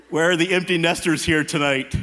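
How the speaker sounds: ordinary talk, nothing marked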